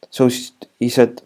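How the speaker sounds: ordinary talk, nothing marked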